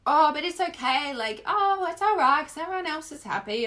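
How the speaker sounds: high, sing-song brush-off; imitating a spiritual bypasser; mock cheerful denial. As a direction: fake brightness papering over sadness.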